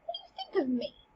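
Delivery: Polonius, offended at the king, in a high squeak. Acted. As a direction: take offence in a falsetto. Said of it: "Polonius gets a bit offended".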